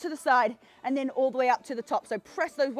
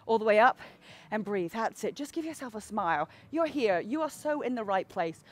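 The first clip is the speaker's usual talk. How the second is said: lower, softer encouraging coach; soft reassuring; 5 semitones lower, 7 dB quieter than usual. Real, not acted. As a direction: ease them gently along.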